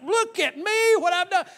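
very high, loud show-off voice; mock boasting. A boaster shows off to everyone, high loud mock bragging. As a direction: crow about yourself like a braggart. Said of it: "They're always boasting about".

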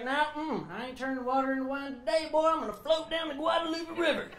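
Texan Jesus. Retold or imitated. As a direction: play Jesus as a laid-back Texan. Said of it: "He's like".

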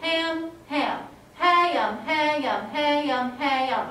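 "Ham" stretched into two syllables, loud. Retold or imitated. Teacher imitating a student's two-syllable "ham". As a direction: drawl "ham" as two beats, "hay-am". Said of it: "about how ham was two syllables".

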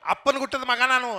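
a loud furious Le, then a boast; angry yell. Retold or imitated. Brawler losing his temper at the other, loud angry yell. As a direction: shout him down in rage. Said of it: "ಸಿಟ್ಟತ್ ಬಿಡ್ತೀನಿ".

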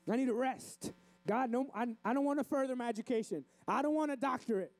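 high, pleading protest to God; mock complaining believer. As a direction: beg off in an exaggerated high voice.

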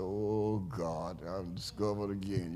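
softly muttered, mock dismay at being recognised; soft wry aside, pretending to be caught out; a quiet grumble of mock resignation. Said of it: "I said".

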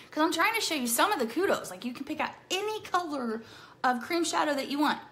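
usual speaking voice

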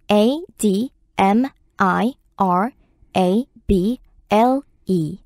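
slow, letter-by-letter spelling; spelled out slowly. Shown as a demonstration. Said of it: "spelling the word out".

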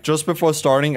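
normal talking voice

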